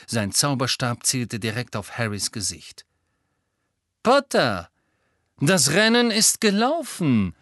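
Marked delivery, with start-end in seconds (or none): 4.0-7.3 drawling villain. A narrator's reading. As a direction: gloat at a cornered rival, stretching the words. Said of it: "sagte Lucius Malfoy gedehnt".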